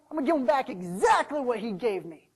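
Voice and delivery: loud, defiant vow; mad. Acted. Servant vows aloud to show his master, angrily defiant. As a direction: punch it out as an angry threat. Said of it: "what I'm mad about".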